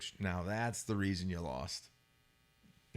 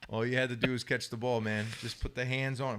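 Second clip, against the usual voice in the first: ordinary talk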